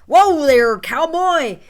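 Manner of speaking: very loud, high holler; called-out challenge. Shown as a demonstration. Player challenging a bluff over threes, yelled. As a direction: yell it out to call the bluff. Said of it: "someone may call".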